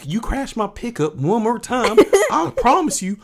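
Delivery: loud, hammed-up Southern threat; Southern accent. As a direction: play a riled country character. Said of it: "I'm gonna start talking in a Southern accent".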